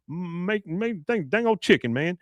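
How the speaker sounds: high, jumbled Boomhauer drawl; Boomhauer mumble. Retold or imitated. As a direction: slur it like Boomhauer. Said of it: "And then Boomhauer says".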